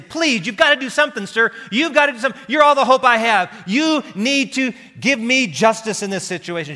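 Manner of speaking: high, loud begging plea; desperate widow pleading with the judge, high and loud; widow crying out. Acted. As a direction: beg the judge with all you have. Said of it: "nag him and cry out to him".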